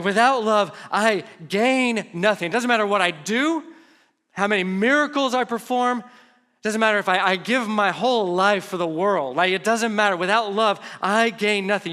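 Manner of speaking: impassioned emphasis. Shown as a demonstration. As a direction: preach it with full conviction. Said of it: "You can't say it much stronger than that".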